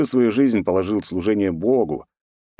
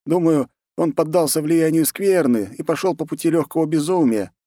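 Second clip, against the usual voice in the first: higher-pitched monk voice; insistent monk; 8 semitones higher than usual. A narrator's reading. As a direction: press your warning on the commander, pitched up. Monk pleads with commander. (настойчивый монах, высоким голосом)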